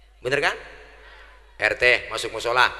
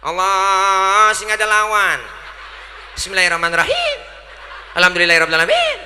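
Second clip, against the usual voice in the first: higher, louder Ambonese chant with a laugh in it; Ambonese prayer; 6 semitones higher, 7 dB louder than usual. Retold or imitated. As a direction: bellow it like an Ambonese friend praying. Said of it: "teman kita dari Ambon". (doa logat Ambon, tinggi dan keras)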